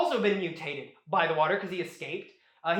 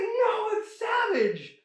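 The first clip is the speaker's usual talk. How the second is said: mock horror; 13 semitones higher, much slower than usual. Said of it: "It's like".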